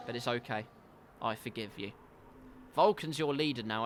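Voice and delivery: ordinary talk, nothing marked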